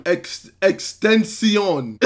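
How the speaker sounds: slow French-accented "extension", ending "see-on". Shown as a demonstration. Narrator teaches the rapper's name, slow French accent. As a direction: spell it out slowly with a French accent. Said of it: "with a French accent".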